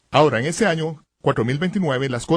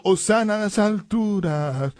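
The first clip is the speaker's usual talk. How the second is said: aclamación imitada; 4 semitones higher, slower than usual. Retold or imitated. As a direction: stretch it like a crowd chanting with palms. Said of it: "moviendo sus palmas y diciendo". (aclamación alta y prolongada)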